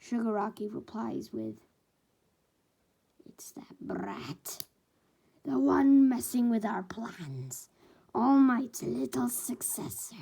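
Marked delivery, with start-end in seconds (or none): 3.2-10.2 slow, hushed, sinister whisper; menacing whisper. Acted. Shigaraki tells All For One who the killer is, whispering. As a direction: whisper the name like a threat.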